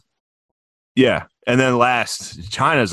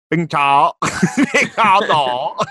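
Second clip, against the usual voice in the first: mock Vietnamese accent; 3 semitones higher, much slower than usual. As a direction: fumble a nonsense imitation of Vietnamese, breaking into laughter. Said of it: "I don't know how to do Vietnam".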